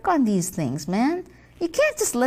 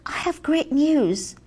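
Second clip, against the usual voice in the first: ordinary talk, nothing marked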